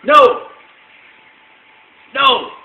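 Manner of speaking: high, punchy shouts mimicking a defiant toddler; toddler shouting. Toddler shouting through the homily at Mass, imitated shout. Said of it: "just kept shouting".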